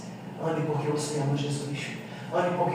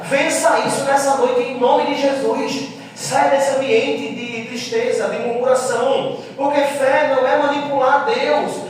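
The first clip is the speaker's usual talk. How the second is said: raised, high-pitched exhortation; full-force preaching; 7 semitones higher, 8 dB louder than usual. Real, not acted. Preacher urging the church to renew faith and joy, full-force preaching. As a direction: build into a loud, driving sermon with stretched-out calls.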